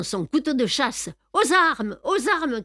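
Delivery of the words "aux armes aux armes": high, raised call to arms; crying out. A narrator's reading. Hero rallying the ship's passengers against pirates, crying out. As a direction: sound the alarm in a high urgent cry. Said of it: "cria-t-il aux voyageurs".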